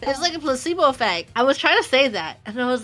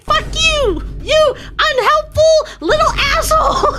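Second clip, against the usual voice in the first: much higher, louder, half laughing; mock fury; 14 semitones higher, 7 dB louder than usual. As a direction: yell at her in joking betrayal. Friend withheld the word, mock rage.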